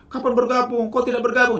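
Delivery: pushy nagging. Shown as a demonstration.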